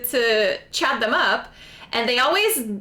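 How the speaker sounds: usual speaking voice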